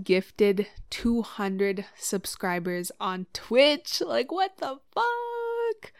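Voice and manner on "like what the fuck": high, slow, drawn-out what the fuck; jaw-dropped squeal of disbelief; can't believe what just happened; stunned disbelief. Real, not acted. Reacting to surprise gift subs.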